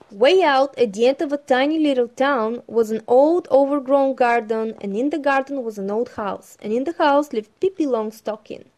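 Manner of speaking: loud, lively storytelling voice; story narration. Acted. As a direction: read it out like a bedtime storybook. Reads the Pippi story aloud.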